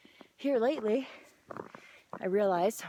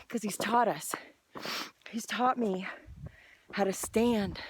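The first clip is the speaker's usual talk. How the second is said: ordinary talk, nothing marked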